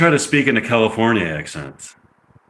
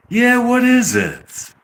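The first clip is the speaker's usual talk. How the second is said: high, drawn-out California accent; annoying California accent; 13 semitones higher, much slower than usual. Shown as a demonstration. Actor trying a line in an annoying California accent. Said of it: "No, that's just annoying".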